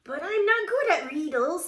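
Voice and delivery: high, loud kitten complaint; comic whiny protest; worried protest. A narrator's reading. Skipito protests the task.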